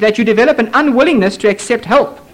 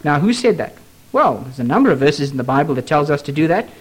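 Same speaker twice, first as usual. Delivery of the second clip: normal talking voice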